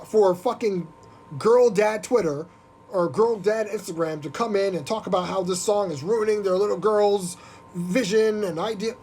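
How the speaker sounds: ordinary talk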